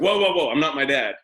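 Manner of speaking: high, loud playful objection; exaggerated high-pitched protest; mock alarmed protest. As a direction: fend it off loudly, half-joking. Acts out own startled protest.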